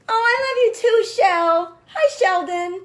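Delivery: loud, high-pitched gush of affection; delighted greeting. Real, not acted. Host spots a favorite runner joining live, delighted greeting. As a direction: call out happily to a dear friend.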